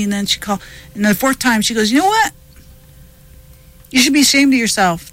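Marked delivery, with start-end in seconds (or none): 1.9-5.1 a friend telling her off, higher and pushier; scolding friend. Retold or imitated. Friend on the phone scolds her for being too busy, mock scolding.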